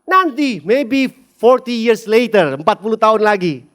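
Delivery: put-on calm your child by stretching the time; reassuring dad. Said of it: "saya bilang".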